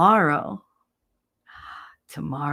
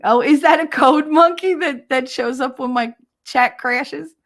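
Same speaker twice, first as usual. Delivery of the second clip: higher, louder startled realization; sudden realization; 8 semitones higher, 6 dB louder than usual. Real, not acted. To chat, suddenly realizing the code monkey, surprised. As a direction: catch on mid-thought.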